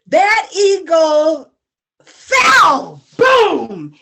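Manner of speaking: slow build into a loud high "fell"; storyteller shouts the fall to the audience; dramatic shout. A narrator's reading. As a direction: land "fell" with a loud cry.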